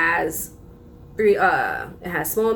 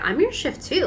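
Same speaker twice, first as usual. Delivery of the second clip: high mock-indignant retort; retold indignant retort; 8 semitones higher than usual. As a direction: throw it back in a raised pitch, as in a retold argument. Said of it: "then I was yelling at her".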